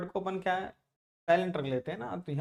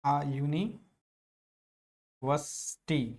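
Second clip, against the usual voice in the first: "it is a university" very slowly, long pauses; drawn-out teaching model of "university"; syllable-by-syllable demonstration; much slower than usual. Shown as a demonstration. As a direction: dictate "university" as yu-nee, pause, vas-tee. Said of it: "इसका pronunciation होता है university".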